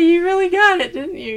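high and loud, teasing; excited. Real, not acted. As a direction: push it up with glee. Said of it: "teasing excited remark".

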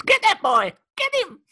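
high, loud yell, going shrill on the second line; hammed-up playground scream; mock shout at kids. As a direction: bellow across the park at the kids. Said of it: "They don't start shouting at the kids".

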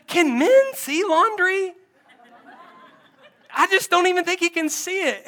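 high, incredulous mock complaint; frustrated wife. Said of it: "It's so frustrating".

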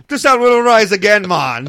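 loud, high hammed-up Southern accent; mock Southern accent. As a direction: belt out a bad Southern accent, like Joey from Friends. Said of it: "I do a Southern accent".

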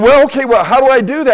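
retold exasperated patient; flustered question. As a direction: throw it back at the doctor, flustered.

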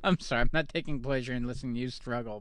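ordinary delivery

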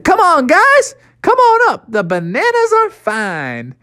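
high calling out from the treetop; calling monkey voice. Acted. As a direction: call down to friends from up high.

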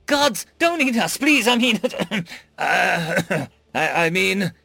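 high, slow, panicky stammering alien; frightened stammer. A narrator's reading. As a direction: stammer it out in terror. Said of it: "the Xyrillian stammered".

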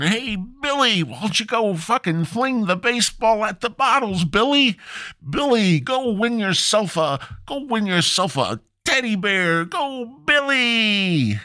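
a parent at the carnival cheering on Billy, high and sing-song. Retold or imitated. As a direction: squeal like a proud mom urging her kid.

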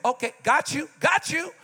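rising high, mock "got you"; sarcastic agreement. Wife mockingly humoring a husband without a plan. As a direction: play along with sarcasm. Mocking woman's skeptical reply.

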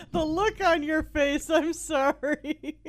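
high, helpless laughter through the words; real laughing fit. Real, not acted. Co-host apologizing over host's look, laughing hard. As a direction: squeeze the words out while cracking up.

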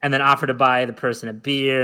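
usual speaking voice

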